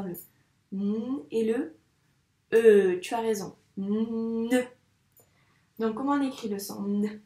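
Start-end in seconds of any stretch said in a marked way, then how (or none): 0.7-3.0 hummed "n", then a loud separate "e"; teacher isolating "n" and "e"; sounds isolated. Shown as a demonstration. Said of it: "Quels sons on entend?". 3.8-4.6 "Ne" with a long hummed n into e; drawn-out teacher's "Ne"; sounds blended slowly. Shown as a demonstration. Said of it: "Quels sons on entend?".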